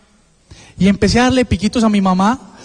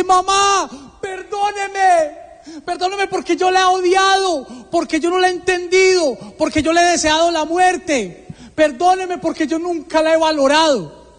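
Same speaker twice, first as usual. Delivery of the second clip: súplica llorosa, aguda y sostenida; llorando a gritos; 8 semitones higher, slower than usual. Retold or imitated. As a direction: ruega perdón con un grito agudo, sin subir el volumen. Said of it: "empiezo a llorar y a gritar". (llanto suplicante a gritos, imitado)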